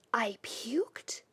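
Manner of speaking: soft unvoiced whisper. A narrator's reading. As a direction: breathe it out in disbelief. Keith's dialogue, whispered.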